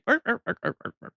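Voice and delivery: high, mocking little dog yaps; yappy dog barks. Streamer mocking a chatter as a yappy dog, high yaps. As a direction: yap like a tiny dog, teasing. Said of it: "this little yappy dog".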